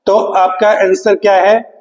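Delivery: usual speaking voice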